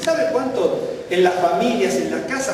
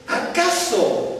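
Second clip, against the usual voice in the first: "¿acaso?" much higher and very slow, falling at the end; drawn-out question word; 9 semitones higher, much slower than usual. Shown as a demonstration. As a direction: stretch "¿acaso?" for the congregation. ("¿acaso?" alargado, agudo y lento, cayendo al final)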